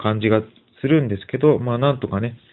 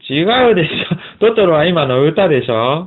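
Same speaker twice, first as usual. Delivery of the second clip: high, louder amused protest; amused correction; 7 semitones higher, 4 dB louder than usual. Real, not acted. Dad corrects the child's quiz answer, amused protest. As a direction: protest the wrong answer through laughter.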